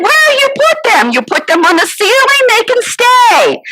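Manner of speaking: high exasperated outburst. Retold or imitated. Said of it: "I said".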